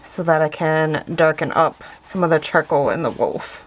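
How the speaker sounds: muffled through a mask. Real, not acted. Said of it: "I'm sorry if I sound muffled, I do have a mask on".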